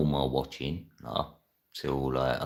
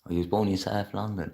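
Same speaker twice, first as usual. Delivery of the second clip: ordinary talk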